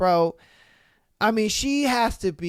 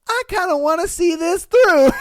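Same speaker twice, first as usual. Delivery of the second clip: put-on pitched way up and loud as the curious girl; high intrigued girl; 11 semitones higher, 8 dB louder than usual. Guy imitating a curious girl, high and loud. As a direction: play the girl, high and eager, hooked. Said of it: "enough to kinda be like".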